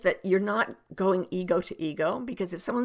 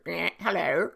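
croaky mock lizard hello; playful creature voice; lizard greeting. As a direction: greet like a human-sized lizard. Imitates lizard saying hello.

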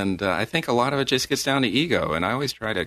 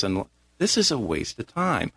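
ordinary talk, nothing marked

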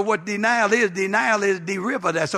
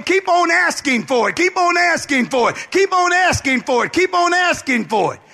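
loud, high insistent refrain; preaching at full force; 6 semitones higher, 4 dB louder than usual. Acted. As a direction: hammer the refrain home. Preaching, repeated exhortation.

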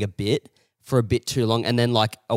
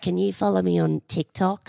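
put-on higher, small child asking; a hint of a child's plea; kid's voice; 8 semitones higher than usual. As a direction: lightly voice a ten-year-old. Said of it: "he was like".

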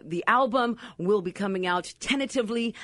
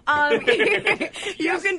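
laughing; 11 semitones higher, much slower than usual. Real, not acted. Giggling through the band plug.

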